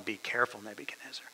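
hushed, whispered caution; whispered warning. Retold or imitated. Watchers whispering a warning to the king. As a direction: breathe the warning softly. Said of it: "What did the watchers tell him?".

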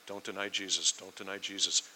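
low, soft repetition; muttered self-reminder. Acted. Peter rehearsing his promise, muttered low. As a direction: say it under your breath to remember.

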